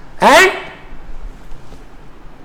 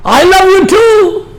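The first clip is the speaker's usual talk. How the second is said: drawn-out reply; 6 semitones higher, much slower than usual. Pretend. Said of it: "तो मौला हुसैन नहीं बोलते".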